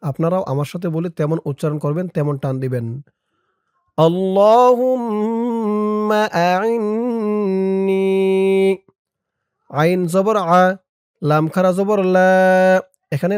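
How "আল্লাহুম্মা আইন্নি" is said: আল্লাহুম্মা আইন্নি chanted very slowly with long vowels; slow melodic আল্লাহুম্মা আইন্নি; stretched recitation. Shown as a demonstration. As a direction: draw out আল্লাহুম্মা like Quran recitation. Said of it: "যেখানে যেমন টান দিব".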